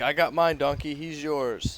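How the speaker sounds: pumped-up battle call. Acted. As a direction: shout to a teammate across the ring. Player calls out in character.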